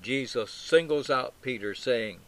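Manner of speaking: ordinary talk, nothing marked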